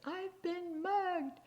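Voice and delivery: put-on high, drawn-out wail of a little old lady; mock-distressed old lady voice; upset old lady. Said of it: "this little old lady who's really upset".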